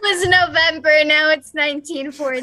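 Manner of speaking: loud, high mocking tease; teasing laugh-shout. Real, not acted. Teasing the flubbed date.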